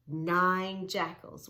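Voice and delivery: NINE drawn out slow and stressed; slow emphatic announcing. Shown as a demonstration. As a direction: announce it slowly, leaning on Nine. Slow emphatic book title count.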